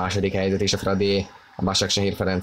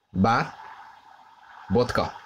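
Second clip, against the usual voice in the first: ordinary talk, nothing marked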